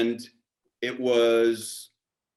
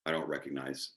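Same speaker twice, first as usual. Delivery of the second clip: ordinary talk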